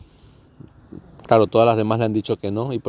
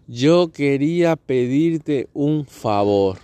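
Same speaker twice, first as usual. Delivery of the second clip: slow, higher, drawn-out ask; slow ominous request; 5 semitones higher, much slower than usual. Retold or imitated. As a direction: stretch it out, the dreaded favor. The dreaded favor request acted.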